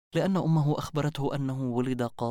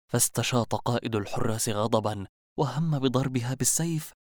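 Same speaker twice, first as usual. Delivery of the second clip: ordinary delivery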